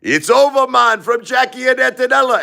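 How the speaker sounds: high, loud Jamaican taunt; Jamaican accent. Retold or imitated. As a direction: deliver the breakup news in a Jamaican accent. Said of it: "Maurice says".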